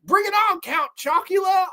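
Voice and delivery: high-pitched mock British taunt; mock-heroic fighting talk; hammed-up challenge. Man dares the vampire to fight, high and hammed-up. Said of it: "he's like".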